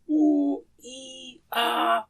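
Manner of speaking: slow, high drawn-out moans of a sick man; groan of pain. Shown as a demonstration. Teacher demonstrates to students how a sick man groans, slow pained moan. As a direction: moan the vowels like someone in pain. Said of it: "تو کیسی آواز نکالتا ہے؟".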